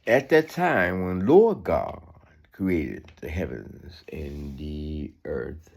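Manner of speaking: slow, deliberate Bible reading; scripture reading. Acted. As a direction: read it out word by word. Reads verses slowly.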